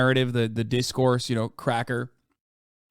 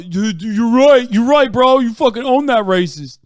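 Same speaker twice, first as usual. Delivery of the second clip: mock bro cheering; 6 semitones higher, 10 dB louder than usual. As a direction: hype him up like a yes-man bro. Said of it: "People just, like, go".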